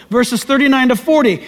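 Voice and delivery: usual speaking voice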